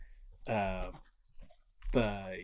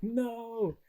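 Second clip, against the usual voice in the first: a high, pained cry of no; daughter's cry of no; 12 semitones higher than usual. Retold or imitated. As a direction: cry out in shock at the loss. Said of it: "she's just like".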